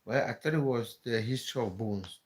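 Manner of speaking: usual speaking voice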